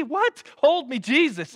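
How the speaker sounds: high squeaky mock panic on What, then a plea; mock terrified yelp. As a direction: play it as a hammed-up frightened disciple. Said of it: "I'd be terrified in this moment".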